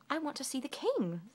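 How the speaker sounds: high, cheerful request; Little Miss Sunshine smiles at the guard; smiling. Acted. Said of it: "smiled Little Miss Sunshine".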